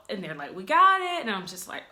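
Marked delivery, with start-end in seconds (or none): 0.5-1.2 cheery nurse. Retold or imitated. Staff answering after surgery.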